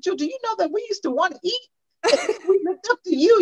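high, excited imitation of the grown kids; grown kids gushing. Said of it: "They said".

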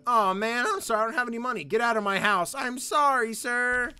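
high, loud cartoonish game dialogue; hammed-up dialogue. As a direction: ham up the exchange. Voicing kid and pirate.

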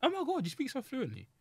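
high-pitched gushing amazement. Retold or imitated. Old guy praising a school play actor, high mock amazement.

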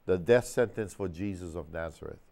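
ordinary delivery

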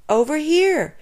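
high-pitched answering call. A narrator's reading. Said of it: "came Lucy's voice".

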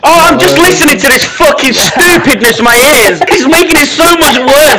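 high-pitched, wound-up complaint; exasperated rant. Real, not acted. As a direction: rant as if driven mad by chatter. Exasperated by the others' chatter.

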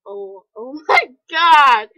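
slow, loud oh my god broken by a laugh; loud horrified laugh. Real, not acted. Seeing another gross picture, a loud slow half-laughing oh my god.

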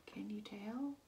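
ordinary delivery

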